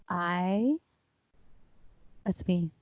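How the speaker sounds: soft, halting that's me; stunned quiet. Retold or imitated. Sitting in silence after the documentary, soft and halting. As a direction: say it soft, barely believing. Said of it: "I'm like".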